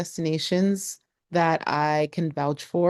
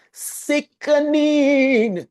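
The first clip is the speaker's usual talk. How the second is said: very slow, high, louder "sickening"; real delighted drawl on "sickening"; drawn-out rave; 11 semitones higher, 5 dB louder, much slower than usual. Real, not acted. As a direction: stretch "sickening" out in awe. Raving about the coast.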